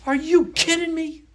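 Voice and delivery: very high, loud, slow outraged shout; furious wife. Retold or imitated. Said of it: "And she goes".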